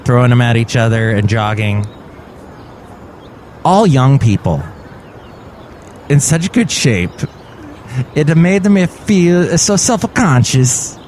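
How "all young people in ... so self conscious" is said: mock Italian accent, lilting and drawn out at the end. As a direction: slip into a hammed-up Italian accent. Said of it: "why did you go into an Italian accent".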